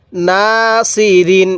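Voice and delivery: নাসিরিন read very slowly, syllable by syllable; slow recitation. Shown as a demonstration. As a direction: draw out নাসিরিন with one alif on না. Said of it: "এরকমভাবে শিখবেন".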